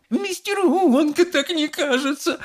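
very high-pitched tearful complaint; through tears. A narrator's reading. Mother accusing Wonka, through tears. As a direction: whine it tearfully as an aggrieved mother. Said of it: "сквозь слёзы проговорила миссис Глуп".

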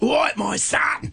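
high, drawn-out Cockney shout; Sweeney-style Cockney. Retold or imitated. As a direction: holler it like a London TV-cop show. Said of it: "if you watch the Sweeney and stuff like".

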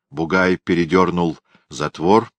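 usual speaking voice

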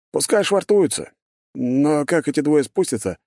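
radio operator voice. Acted. Base relays lord's orders.

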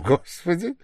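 high, loud, voluble prattle; fussing, chattering investigator. A narrator's reading. Investigator recounting the friend's visit, fussing excitedly. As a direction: rattle on with exclaiming concern.

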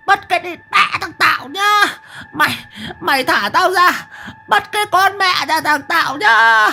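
very high wailing screech; frantic high-pitched curses and long wails; cursing old woman. A narrator's reading. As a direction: scream abuse, dragging out the last words. Mad mother screaming curses again.

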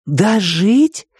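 high shocked echo question; boy stunned by the cat's words. Acted. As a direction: repeat it in disbelief. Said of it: "ошеломлённо переспросил Тим".

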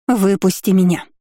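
urgent hissed demand; hissing plea. A narrator's reading. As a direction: hiss it through your teeth. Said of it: "зашипела Диона".

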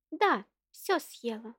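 put-on slow, proudly drawn out child; proud little girl. A narrator's reading. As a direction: say it proudly, lifting the chin. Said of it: "гордо вскинула голову Виктория".

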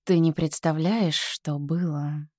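slow seductive breathy voice. Shown as a demonstration. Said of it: "В соблазнительных фразах". (соблазнительное придыхание)

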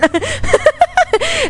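high giggle. Real, not acted. Laughing at own joke.

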